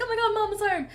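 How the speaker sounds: be the dog thrilled Mama's back; voicing the dog. Dog running up wagging, voiced as thrilled pet. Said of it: "being like".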